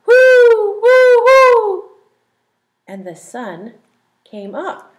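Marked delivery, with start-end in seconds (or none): 0.0-1.9 high, loud owl hoot. A narrator's reading.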